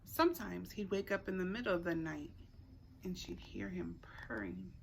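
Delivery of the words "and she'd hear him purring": breathy whisper to end the line. A narrator's reading. Reader whispering the night scene to the listener, whispered. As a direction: hush as if the cat might wake.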